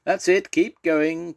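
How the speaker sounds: high, loud, drawn-out call. A narrator's reading. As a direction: call slowly up the line from the back. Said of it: "called Ralph from the back".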